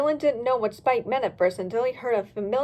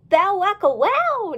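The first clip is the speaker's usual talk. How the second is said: slow, high gleeful cheer; 7 semitones higher, 6 dB louder, slower than usual. Acted. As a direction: cheer the catchphrase out. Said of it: "Dolly cheered".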